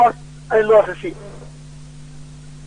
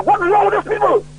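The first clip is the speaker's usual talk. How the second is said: shouting; 6 semitones higher than usual. Real, not acted. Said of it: "You need to stop shouting".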